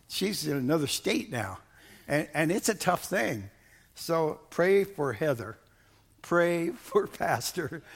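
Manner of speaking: ordinary talk, nothing marked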